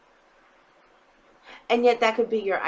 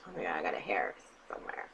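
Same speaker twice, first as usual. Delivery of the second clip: fast, low whispered mutter; quick hushed whisper to self; whispered aside; 11 dB quieter, faster than usual. Shown as a demonstration. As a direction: whisper it quickly, much lower and softer. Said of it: "I have to say it with that voice".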